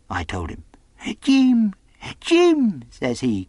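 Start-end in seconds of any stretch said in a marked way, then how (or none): 1.2-2.8 high, loud, delighted echo; pleased castaway. A narrator's reading. Castaway repeats the boy's name, quite pleased. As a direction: savour the name in a high character voice. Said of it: "quite pleased, apparently".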